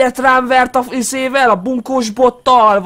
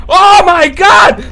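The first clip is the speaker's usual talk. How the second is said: slow loud scream; frightened drawn-out shout; 5 semitones higher, 6 dB louder, much slower than usual. Real, not acted. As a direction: scream as she catches you. Being caught by granny.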